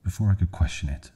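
ordinary delivery, nothing marked

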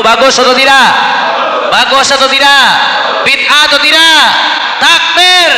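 loud, rising call to the crowd; rallying shout. Real, not acted. Preacher calling the congregation to respond, loud rallying shout. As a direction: whip up the congregation for an answer.

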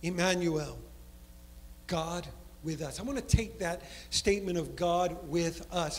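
ordinary talk

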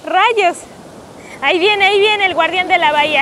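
loud, high cheering; excited shout. Real, not acted. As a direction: call out with thrilled energy. Wife cheering husband in waves.

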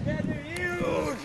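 very high, drawn-out yell; panicked cry. Acted. Paul fleeing the huge bees, high drawn-out cry. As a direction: wail it out in alarm.

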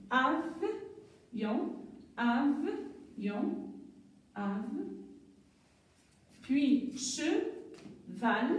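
put-on slow, stretched for young pupils; teacher slowly sounding out cheval; drawn-out syllables. Shown as a demonstration. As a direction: drag the word out syllable by syllable. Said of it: "j'entoure tous les syllabes".

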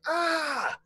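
sharp high yelp of pain as his nose is cut; high pained cry. Acted. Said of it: "he cries out".

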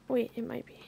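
hushed hopeful whisper. Real, not acted. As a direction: whisper it to yourself. Whispered aside.

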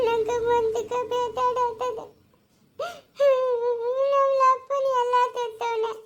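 slow, drawn-out crying lament; tearful wailing. Acted. Woman pouring out her hurt to Tarunya, wailing tearfully. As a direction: sob through long, dragged-out words. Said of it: "ನೀನ್ ಅಳ್ಬೇಡ".

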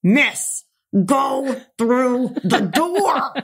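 slow, pointed and knowing; meaningful attendant hint. Retold or imitated. The attendant nudges her toward the open door, slow and knowing. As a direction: drag it out so she gets the hint.

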